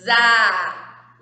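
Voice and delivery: Zá buzzed out loud with a strong Z; teacher showing the Z sound. Shown as a demonstration. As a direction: call out Zá with a buzzing Z. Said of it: "Não vou escrever com Z".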